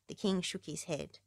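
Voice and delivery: ordinary delivery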